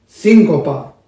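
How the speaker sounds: síncopa with SÍN hit loud and the rest held long; accent then sustain. Shown as a demonstration. Teacher showing an accent followed by a held sound, loud. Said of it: "lo puedo sostener más después".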